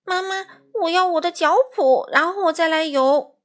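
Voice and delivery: high, loud mock-crying child's call. A narrator's reading. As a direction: call out to mum in acted distress. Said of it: "哭叫着". (带着哭腔高声喊妈妈)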